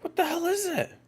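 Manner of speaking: very high, squeaky disbelief; baffled falsetto. Real, not acted. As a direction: squeal it in bewilderment. Baffled by a mysterious ticking sound.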